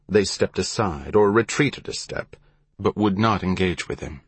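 plain reading voice

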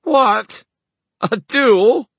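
very high, loud incredulous questions; shrieked, mocking astonishment; disbelief. A narrator's reading. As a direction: scoff at the absurd idea, word by word. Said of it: "laughed in disbelief".

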